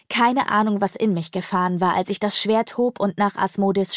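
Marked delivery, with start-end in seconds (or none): none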